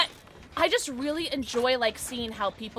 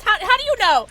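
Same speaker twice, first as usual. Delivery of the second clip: raised-voice incredulous questioning; astonished outburst; 11 semitones higher, 10 dB louder than usual. Real, not acted. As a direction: demand it in loud amazement. Mock outrage at being found out.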